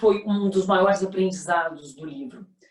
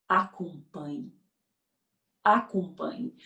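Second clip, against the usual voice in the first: slow emphasis; 4 semitones lower, 4 dB quieter, much slower than usual. Shown as a demonstration.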